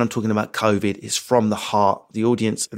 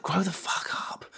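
whispered scolding; 8 semitones higher, 10 dB quieter than usual. Pretend. Scolding his hair, whispered.

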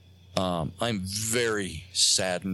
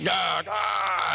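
high-pitched snarling roar, mimicking a ghost; growling; 10 semitones higher than usual. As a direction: growl like a man on a haunted recording. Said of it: "this man's voice growling again".

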